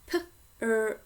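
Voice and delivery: "P-R-O-P-E-R" sounded out sound by sound. Shown as a demonstration. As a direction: segment "P-R-O-P-E-R" slowly for children.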